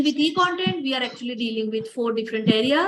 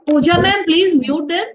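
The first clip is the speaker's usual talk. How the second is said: loud, raised call over the noise; 4 semitones higher, 7 dB louder than usual. Real, not acted. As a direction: call out sharply to get her attention. Calling out over background noise.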